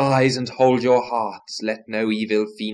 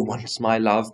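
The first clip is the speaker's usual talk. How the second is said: ordinary delivery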